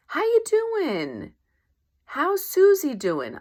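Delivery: high, slow, sing-song mock question; chirpy small talk. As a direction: play the cheery acquaintance. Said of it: "And she goes".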